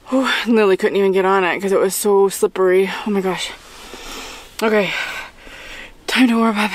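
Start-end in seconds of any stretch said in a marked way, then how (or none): none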